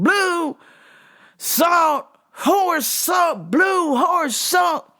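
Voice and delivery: mock customer yell, high and drawn out; imitated yelling. Customer to worker four feet away, yelling. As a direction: bellow each word like he can't hear himself. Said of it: "Why are you yelling?".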